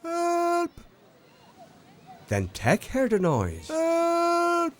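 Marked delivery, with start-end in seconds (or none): 0.0-0.7 a far-off call, much higher; high cry for help. A narrator's reading. Someone in trouble calling for help. 3.7-4.7 a far-off call, much higher; high cry for help. A narrator's reading. Someone in trouble calling for help.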